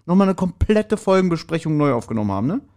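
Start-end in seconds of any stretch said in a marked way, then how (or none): none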